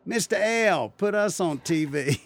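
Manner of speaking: high, loud mock kids' yell; imitating Southern kids hollering across the yard. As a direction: call out like the little neighbour kids. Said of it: "they would yell".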